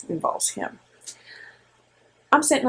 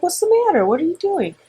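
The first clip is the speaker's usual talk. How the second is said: put-on mother to toddler; 11 semitones higher than usual. Mother voicing herself to toddler.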